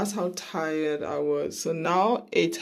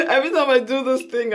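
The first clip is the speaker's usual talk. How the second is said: fast, higher and louder, laughing all through; laughing through tears; 6 semitones higher, 5 dB louder, much faster than usual. Real, not acted. Telling viewers she always cries here, laughing fast. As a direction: laugh at her own watery eyes. Laughing while eyes water.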